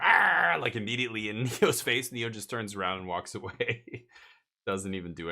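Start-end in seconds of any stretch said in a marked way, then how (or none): 0.0-0.6 much louder, higher mock little-dog snarl; dog snarl imitation. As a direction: snap like a tiny angry dog. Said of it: "the little one's like".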